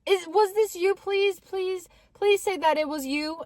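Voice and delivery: imitated begging, high-pitched; high, urgent plea, acted out; pleading sister. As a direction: beg anxiously for a confession. Sister begging her to confess.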